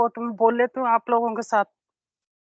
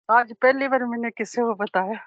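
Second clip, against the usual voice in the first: ordinary delivery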